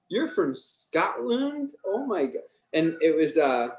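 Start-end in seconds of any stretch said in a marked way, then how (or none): 0.1-2.4 high, drawn-out astonishment; mock-amazed, stretched question sliding upward; amazed reaction. People reacting to a Scottish accent, amazed. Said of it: "a lot of people were like".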